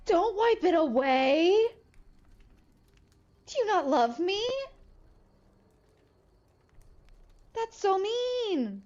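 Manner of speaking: high, whiny fake hurt pleading; mock heartbroken. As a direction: ham up being unloved and wounded. Mock hurt begging for love.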